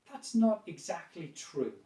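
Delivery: slow, falling, non-aggressive disagreement; downward tone. Shown as a demonstration. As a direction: disagree slowly, letting the tone drop. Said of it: "tone of voice more down".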